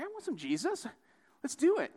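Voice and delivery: high-pitched eager acting; mock eagerness. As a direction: jump in keenly, voice way up. Acted eager convert.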